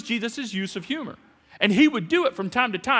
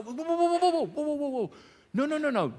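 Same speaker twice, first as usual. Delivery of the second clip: high rising whoa to halt the complaining; stopping them; 7 semitones higher than usual. Acted. Preacher halting the complainers with a high whoa. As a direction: throw up the brakes with whoa.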